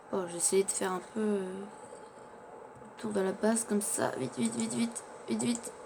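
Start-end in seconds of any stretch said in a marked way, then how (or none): none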